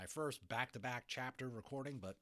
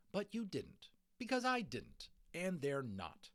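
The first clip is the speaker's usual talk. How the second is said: exasperated mother; 7 semitones higher, 4 dB louder, slower than usual. Acted. Mother scolding.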